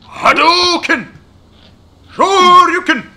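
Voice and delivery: high shouted special move call; fighting game shout. Pretend. Streamer calling out fighting game moves. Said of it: "various other fighting references".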